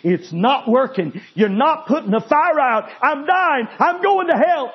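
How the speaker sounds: a doomed man screaming that he is going to hell; screaming in terror. Retold or imitated. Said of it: "he started screaming".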